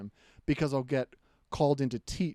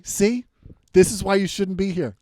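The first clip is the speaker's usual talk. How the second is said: louder, higher smug told-you-so; mock passive aggressive jab; 4 semitones higher, 8 dB louder than usual. Comedian mocking a newcomer, smug and loud. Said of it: "as if to say".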